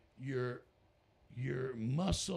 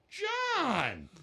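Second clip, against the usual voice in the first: loud, high call across the balconies; 10 dB louder than usual. Retold or imitated. Ronnie calling to John from the next balcony, loud and high. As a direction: shout his name in happy surprise. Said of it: "Ronnie goes".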